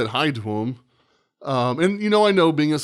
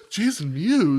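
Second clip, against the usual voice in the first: higher, with a gasp and laugh; surprised recognition; 4 semitones higher, slower than usual. Retold or imitated. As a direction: gasp as it dawns on you.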